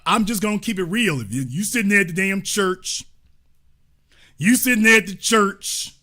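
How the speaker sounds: normal talking voice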